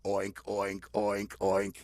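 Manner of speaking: deep, deadpan oinks; pig oinks. Acted. Old piggy answers after all the wailing, low oinks. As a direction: drop very low, evenly spaced. Pig alone keeps its own noise.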